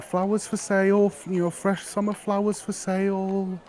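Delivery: high street seller's call, flowers for sale. Acted. Flower girl calling to passers-by in the square, high and bright. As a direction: sing it out like a market flower girl. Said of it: "calling out saying".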